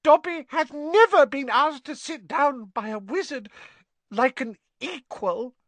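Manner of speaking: high, tearful squeaky elf; overwhelmed house elf. Acted. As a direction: overcome with emotion in a high little voice.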